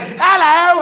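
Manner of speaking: high, loud sing-song British "Hello."; hammed-up British greeting; mock British hello. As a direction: chirp "Hello." like a cheery Londoner. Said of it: "I don't like British greetings".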